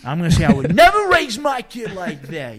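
loud, slow indignant father; mock righteous rant; indignant parent.

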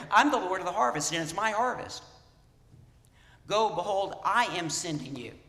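Jesus proclaiming. Retold or imitated. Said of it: "he's making very clear".

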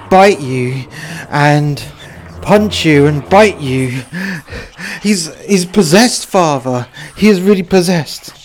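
put-on scared parent cries out that the boy is possessed; panicked plea. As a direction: beg the priest, terrified. Parent panics about possessed son.

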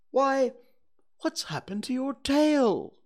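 suddenly notice something is missing; in surprise. Acted. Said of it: "he said in surprise".

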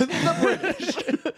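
high, slow laughing The British; drawn out through laughter. Real, not acted. Laughing jab at the Empire.

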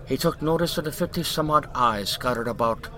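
usual speaking voice

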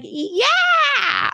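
high, drawn-out mock squeal of yes; eager cheer. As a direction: retell a thrilled, eager shout. Mom and daughter eagerly accept offer.

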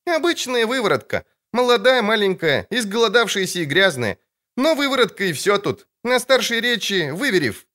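put-on a sharp, high girlish retort; girl's voice. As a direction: contradict him like a cheeky girl. Ciri lecturing the barker.

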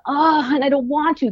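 imitating a daughter whining she won't get ready; whiny kid. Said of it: "starts giving me any kind of a".

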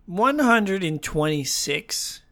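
ordinary talk, nothing marked

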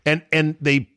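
ordinary delivery

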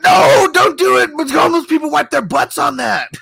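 high, loud exaggerated cry of objection; hammed-up pleading yell; mock panicked protest. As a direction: wail it in mock horror, way up high. Acting panicked protest from retold scene.

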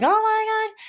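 high exhilarated whoop; thrilled holler. Retold or imitated. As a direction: let the adrenaline fly up high. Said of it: "hollering and just like".

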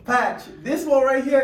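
loud, high hype call. Real, not acted. Host presenting the guest with loud excitement. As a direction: shout it out like a hype man.

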